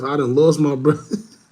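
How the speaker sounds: normal talking voice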